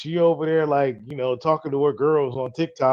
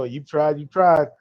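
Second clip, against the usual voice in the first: usual speaking voice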